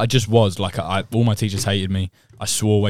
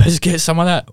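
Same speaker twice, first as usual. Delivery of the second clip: eager; 8 semitones higher, 5 dB louder than usual. Retold or imitated. Echoing the eager quote.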